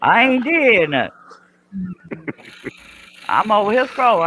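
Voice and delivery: high, loud whiny denial; high mock protest. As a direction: play the innocent, high and whiny. Mock innocent protest to friends.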